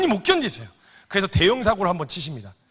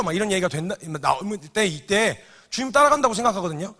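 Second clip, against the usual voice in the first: ordinary delivery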